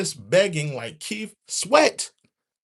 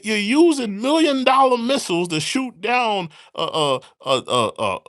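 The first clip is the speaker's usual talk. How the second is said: normal talking voice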